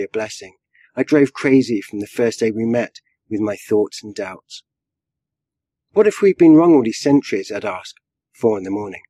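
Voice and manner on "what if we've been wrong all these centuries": higher, louder anxious outburst; fretful questioning. A narrator's reading. Rabbi frets to his wife at night, anxious questioning. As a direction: blurt the doubt out, worried.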